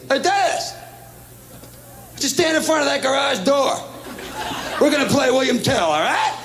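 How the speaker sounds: put-on high, hollered kid's orders; older brother calling out.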